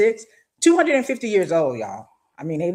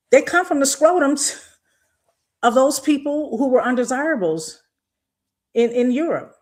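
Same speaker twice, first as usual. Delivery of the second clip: heated raised voice; 6 semitones higher than usual. Real, not acted. Angry rant about undesirables.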